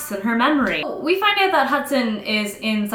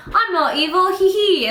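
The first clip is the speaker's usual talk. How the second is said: high, loud mock-innocent voice; hammed-up protest with a fake giggle; Hudson; 8 semitones higher, 4 dB louder than usual. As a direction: playfully imitate a giggling villain. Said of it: "like, Hudson being like".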